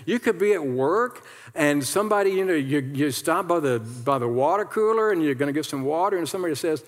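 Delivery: normal talking voice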